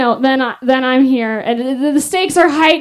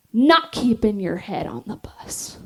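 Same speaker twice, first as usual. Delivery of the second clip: slow whisper; 4 semitones lower, 6 dB quieter, slower than usual. Retold or imitated. Instructor whispering the driver warning to the recruits. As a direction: breathe the warning slowly. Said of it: "and then she goes".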